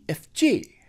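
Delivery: ordinary talk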